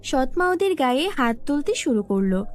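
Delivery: usual speaking voice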